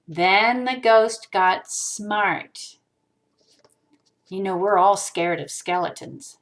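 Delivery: ordinary delivery, nothing marked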